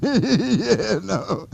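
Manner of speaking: real laughter breaking through the words; laughing. Real, not acted. Teacher laughs at his own movie story, laughing. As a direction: giggle through it, amused at the story.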